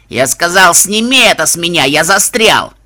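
loud furious yell, stuck; angry yell. Acted. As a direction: bellow in frustration. Judge stuck, yelling angrily.

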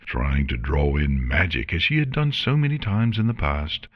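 ordinary delivery

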